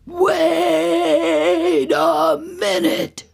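high, loud, hugely drawn-out outcry; alarmed Papa Bear. Acted. Papa Bear finds his chair used, long loud cry. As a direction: stretch the shocked cry right out.

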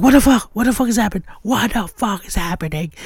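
high, loud, frantic yelling; panicked scream. Retold or imitated. Girlfriend screaming in terror in the car. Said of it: "she was like".

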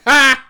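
high, loud single "ha"; imitated sibling jeer; mocking sister's laugh. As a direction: burst out one gloating mock laugh. Voicing gloating sister.

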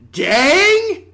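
Dang cried out loud and high; high shouted Dang. Pretend. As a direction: wail Dang like a hammy actor. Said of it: "I will now do a dramatic monologue".

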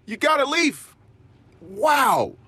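high encouraging praise; high happy praise for a one-year-old's leaf; praising a toddler. Shown as a demonstration. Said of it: "give the one-year-old that feeling".

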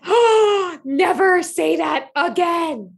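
high, loud, slow "never say that again"; playful horror at husband's word; mock horrified plea. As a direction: beg him to stop, hammed-up. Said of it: "I was like".

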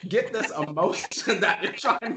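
high-pitched, through giggles; giggly high-pitched questioning; laughing. Real, not acted. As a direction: ask while cracking up. Laughing while asking friend's emotion.

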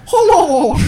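very high squeaky turkey hello. Acted. GM voices turkey saying hello.

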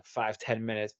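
ordinary talk

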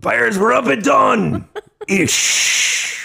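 one last pirate line. Acted. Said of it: "I'll stop now".

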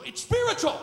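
high, loud preacher's cry; emphatic rebuttal. Real, not acted. Preacher refuting a dismissed thought, emphatic shout. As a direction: burst out in fierce insistence.

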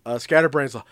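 ordinary delivery

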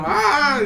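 comic burst of a scream; imitated scream. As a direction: let out a pretend wake-up yell. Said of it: "el mae se despierta gritando".

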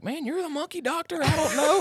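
very high, squeaky protest; panicked colleague. Retold or imitated. Vet imitates colleague passing the buck on monkey, high-pitched protest. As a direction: pitch it way up, a hammed-up helpless colleague. Acts out colleague's helpless reply.

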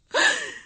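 sharp panicked gasp; high gasp. Acted. Frightened mother, sharp gasp. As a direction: catch the breath in fear.

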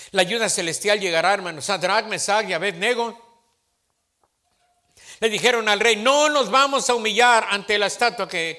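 loud fired-up preacher; loud sermon delivery; preaching. Acted. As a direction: proclaim it to the congregation. Pastor preaching at full force.

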